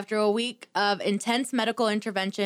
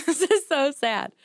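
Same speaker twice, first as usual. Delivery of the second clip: giggly; 5 semitones higher, 3 dB louder than usual. Real, not acted. Mock-solemn echo while laughing.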